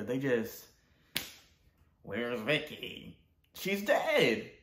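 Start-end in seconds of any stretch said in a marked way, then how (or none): none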